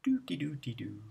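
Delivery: ordinary delivery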